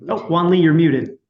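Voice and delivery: calling out. Real, not acted. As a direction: raise your voice to catch her attention. Host calls out to muted speaker.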